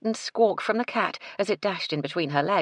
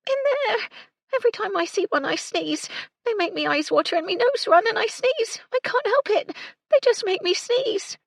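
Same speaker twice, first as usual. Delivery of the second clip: put-on much higher, tearful girl voice; whiny young maid; 5 dB louder than usual. A narrator's reading. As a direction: plead like a snivelling child.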